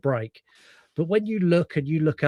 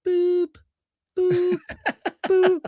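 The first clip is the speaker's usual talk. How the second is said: high beeping like a truck backing up; mock reverse warning beeps; reversing beeps; much slower than usual. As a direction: imitate a reversing alarm, boop boop. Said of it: "the only thing that's missing is".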